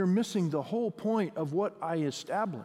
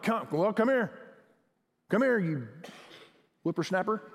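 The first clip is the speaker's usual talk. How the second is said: mock gruff, impatient summons; gruff scolding. Shown as a demonstration. Pastor shows congregation a gruff, scolding God. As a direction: call them over like a scolding elder. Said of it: "So the tone is not like".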